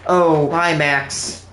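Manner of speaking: ordinary talk, nothing marked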